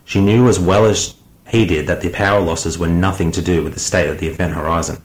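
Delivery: plain reading voice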